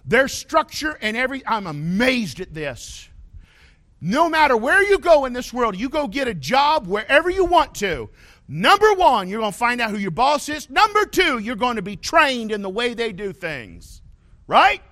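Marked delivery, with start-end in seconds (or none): 4.0-14.8 loud, high preacher's call to the congregation; full-force preaching. Acted. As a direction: preach it out loud, rising to each point.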